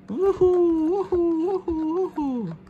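high sing-song pretend whoops; excited whooping. Troll getting into the cold water tub, high whooping.

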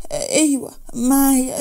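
slow, tearful answer; crying. Acted. Manal to Rahma admitting she has the paper, crying. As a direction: choke the words out through tears.

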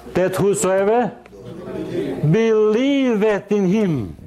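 proclaiming to the congregation; declaimed scripture. Acted. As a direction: draw out the verse solemnly.

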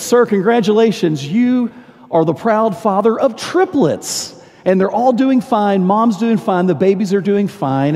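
proud nurse, stretch out the good news; cheerful nurse. Acted.

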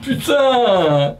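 long, falling exclamation; one long falling curse; drawn-out swear. Real, not acted. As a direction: stretch the word out, sliding down. Drawn-out swear after a mishap.

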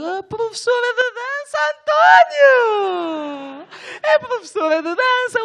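very high, slow, hammed-up whine; overjoyed fake sob; mock ecstatic cry. Telling António about the dance teacher, hammed-up high cry. Mock-crying excitement to friend.

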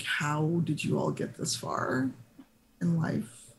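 slow, soft, weary disbelief; exasperated eye-roll. Acted. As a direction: ask it slowly, utterly unimpressed. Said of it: "he rolls his eyes".

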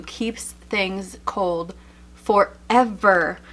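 slow, stretched praise on "forever"; drawn-out emphasis. Real, not acted. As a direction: lean into "keeps things cold forever" slowly. Raving about the water bottle.

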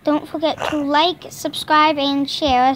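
loud upbeat sign-off; youtuber outro. Acted. Kid doing the channel outro, loud and upbeat. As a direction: sell the subscribe line brightly.

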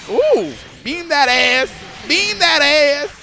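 slow, drawn-out hype, rising at the end; hyped call. Real, not acted. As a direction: stretch every word, cheering the hit.

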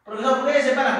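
student's question. Acted. Teacher acting a student who asks why, questioning. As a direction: pipe up as a puzzled pupil.

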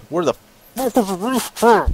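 high-pitched burst of real frustration; exasperated outburst. Real, not acted. Loses patience hunting for the racetrack.

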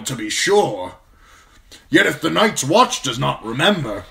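put-on a gruff old commander praising a young man; gruff old lord commander. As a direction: speak as the stern old Lord Commander, grateful but brusque. Narrator voices Lord Mormont.

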